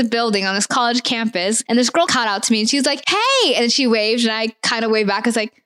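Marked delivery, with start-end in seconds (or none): 3.1-3.5 high-pitched, mock eager greeting; imitated friendly call-out. Girl calls out to me on campus, imitated high greeting. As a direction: call out high like the keen stranger. Retold girl calling out to her.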